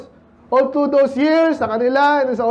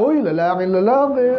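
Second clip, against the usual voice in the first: mock radio announcer. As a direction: play at being a smooth radio announcer. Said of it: "parang announcer".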